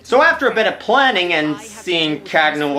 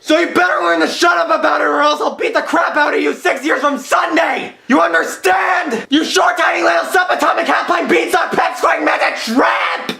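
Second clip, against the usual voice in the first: furious shouting; 10 semitones higher than usual. Acted. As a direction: yell every insult at him. Continuing the insult rant.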